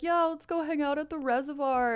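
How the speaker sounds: lightly voiced small-town teenager; imitating bored teen friends. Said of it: "our friends would be like".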